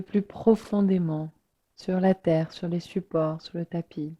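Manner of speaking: unhurried meditation voice with long pauses; guided relaxation. Real, not acted. Yoga teacher inviting listeners to let the body sink, guided relaxation. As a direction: guide listeners gently into rest, letting phrases settle.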